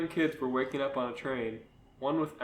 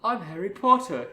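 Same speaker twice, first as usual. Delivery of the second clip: put-on higher, louder British boy through laughter; British Harry Potter voice; 5 semitones higher, 5 dB louder than usual. A narrator's reading. Boy Harry introduces himself to the girl, British voice. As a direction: introduce yourself in amused storybook British. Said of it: "I love your Harry Potter voice".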